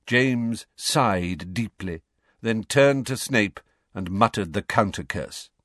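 ordinary delivery

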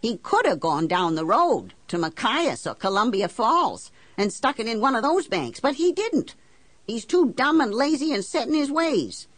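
high-pitched, exasperated argument; indignant, demanding tirade; heated insistence. Acted. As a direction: press the banker hard for an answer. Argues heatedly with banker.

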